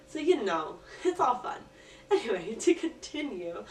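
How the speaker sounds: giggling through the words; laughing. Real, not acted. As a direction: laugh it off, brighter. Laughs at own messy setup.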